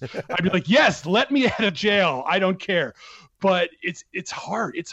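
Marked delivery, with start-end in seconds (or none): 0.7-2.9 higher, louder mock-desperate outburst; eager mock plea. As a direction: blurt it out in playful eagerness. Imagined reply to the jailers.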